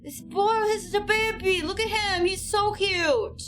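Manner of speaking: high, squealing delight over a cute outfit; gushing excitement. Real, not acted. Gushing over character's new outfit.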